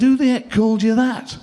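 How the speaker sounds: a holiday-camp host aghast at the name; incredulous Bolton host. Retold or imitated. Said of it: "he said".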